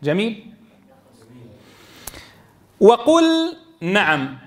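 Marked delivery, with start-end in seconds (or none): 2.8-4.4 "وقل نعم" slowly, the l fully sounded before n; clear unmerged lam. Shown as a demonstration. Teacher showing lam before nun, l held clear. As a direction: hold the l of "وقل" long, then a separate "نعم". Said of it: "أظهر اللام عند النون".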